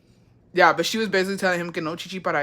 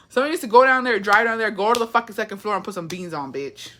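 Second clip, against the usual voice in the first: ordinary talk, nothing marked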